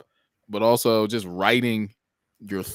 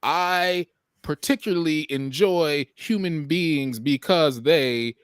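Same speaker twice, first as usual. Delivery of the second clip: slow, deliberate, higher and precise; careful rapper wording; 5 semitones higher, slower than usual. Retold or imitated. Rapper choosing each word with care, slow and deliberate. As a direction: weigh every chosen word slowly. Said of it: "as a rapper".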